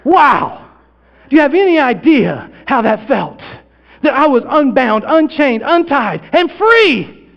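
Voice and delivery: high, loud, rising jubilation; overjoyed, ringing exclamations; exultant. Acted. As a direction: build to a joyous cry of freedom. Exulting at being released.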